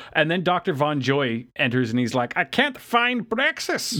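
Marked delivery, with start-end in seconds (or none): 2.4-4.0 high, drawn-out mock German alarm; panicked German professor. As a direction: imitate a frantic German scientist raising the alarm.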